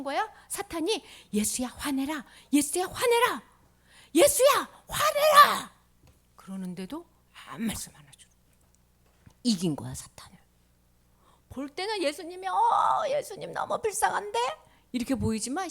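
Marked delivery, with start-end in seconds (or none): 1.3-5.7 starts plain, builds to a high shout; provoke, louder and higher each time; goading, building up. Acted. Satan goading Jesus to get angry, building to a shout. 12.5-14.4 higher pitched mock dismay; acted pitying surprise. Onlookers seeing Jesus suffer, high pitying surprise.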